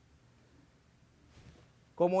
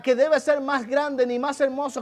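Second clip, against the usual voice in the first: loud, high sermon push; rally the congregation from the pulpit; full-force preacher; fervent preaching; 12 semitones higher, 9 dB louder than usual. Acted.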